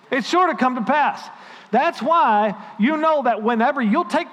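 stirring the church, building up the word; fervent preaching. Acted. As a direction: preach it, climbing with conviction. Pastor preaching with rising fervor.

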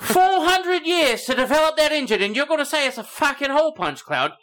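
very high, outraged Cockney rant; Cockney accent. Acted. Alien insulted over its engine, high Cockney outrage. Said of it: "came down and had a Cockney accent".

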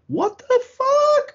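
slow, high, loud disbelief; shocked shout. Retold or imitated. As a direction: scream it in total shock. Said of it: "and I'm just like".

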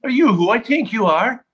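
a drunk fan starstruck, lightly voiced; excited disbelief. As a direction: gasp it out at a star. Drunk fan's retold question.

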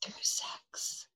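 whispered coyly. Pretend. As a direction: breathe the words in a sly whisper. Coy answer about the ritual.

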